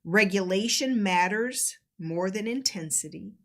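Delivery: slow, measured delivery with long pauses; deliberate, reflective teaching read; reading out guidance. Acted. Reads out guidance on emotional safety.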